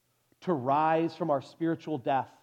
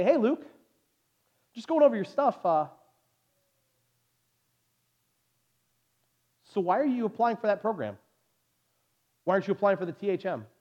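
higher, louder upbeat caller voice; lightly voiced official on the phone, pitched up; caller from the seminary; 5 semitones higher, 4 dB louder than usual. Voicing the seminary's phone call.

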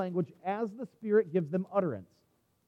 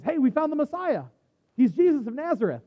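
higher, louder, eager news-breaking; excited announcement; 5 semitones higher, 6 dB louder than usual. Acted. Philip telling Nathanael about the Messiah, excited. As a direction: announce a great discovery.

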